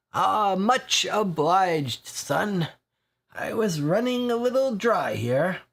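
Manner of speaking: a dry regular perking up over a free pint; lightly voiced weary old drinker; thirsty old drinker.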